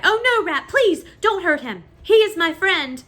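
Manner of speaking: high, loud pleading; cried out. A narrator's reading. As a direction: beg the rat desperately. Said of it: "The princess cried".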